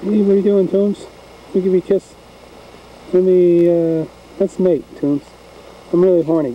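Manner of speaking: high, silly talking-dog voice; pretend dog voice. Dog propositions the cat, high mock-animal voice.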